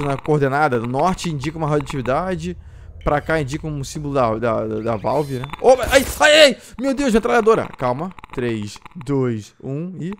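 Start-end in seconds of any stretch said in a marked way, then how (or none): 5.6-7.7 high, loud panicked cries; real fright at a sudden threat; scared yelp. Real, not acted. Gamer ambushed by a machine gun enemy, scared yelp.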